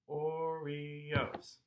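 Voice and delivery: soft chant of "Oreos" in three beats; "Oreos" softly in cherry pie rhythm; matching rhythm. Shown as a demonstration. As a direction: draw out "Oreos" as O-re-os. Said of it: "would match up with".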